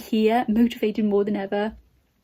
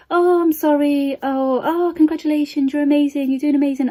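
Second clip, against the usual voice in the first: higher, louder gushing sympathy; mock sympathy; 5 semitones higher, 4 dB louder than usual. Vlogger imitating followers' sympathetic comments, mock gushing. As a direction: gush like a well-meaning follower. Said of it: "for people to go".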